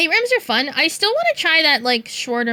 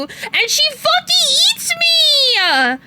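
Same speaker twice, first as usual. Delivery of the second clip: high, drawn-out cry of outrage; outraged wail; 14 semitones higher, 4 dB louder, much slower than usual. Retold or imitated. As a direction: wail it slowly, high-pitched and indignant. Indignant retelling of being eaten.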